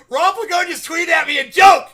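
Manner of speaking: overjoyed streamer shouting about a fan's joke; excited shouting. Real, not acted. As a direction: yell with pure delight at the joke.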